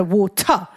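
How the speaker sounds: slow "water" with a crisp, fully sounded T; over-enunciate "water"; enunciated water. Retold or imitated. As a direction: say "water" carefully, hitting the T in the middle. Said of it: "he has to pronounce the".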